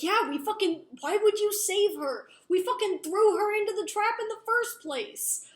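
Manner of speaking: loud, high-pitched retold rant; acted outrage. Brother demands why Jace saved her, loud acted fury. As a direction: raise voice and pitch, indignant, as in a quarrel.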